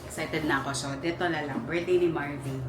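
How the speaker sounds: hushed, sneaking whisper; whispering while hiding. Real, not acted. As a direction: keep it low so nobody hears. Sneaking in for surprise birthday.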